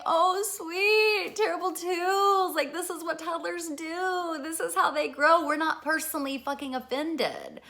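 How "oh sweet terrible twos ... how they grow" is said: put-on high, loud, sing-song delight at the toddler; cheerful parent. Mother delighting in her toddler's terrible twos, a high, loud, cheery voice. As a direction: beam at the tantrum, higher and brighter in tone. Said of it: "but we're like".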